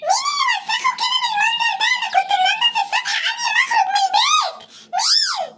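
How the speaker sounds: hysterical wailing. Acted. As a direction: wail that her clean house was ruined. Rabbit finds house dirtied.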